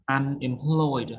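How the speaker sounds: "Unemployed" slowly, stressed on the last syllable; stress on PLOYED. Shown as a demonstration. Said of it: "Stress on employed".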